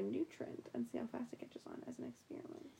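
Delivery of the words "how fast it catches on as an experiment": whisper. Real, not acted. Whispered scheming suggestion.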